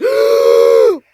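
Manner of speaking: a loud gasp of waking; sharp loud gasp. Acted. Hero waking from death with a loud gasp. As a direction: gasp back to life. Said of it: "She gasps".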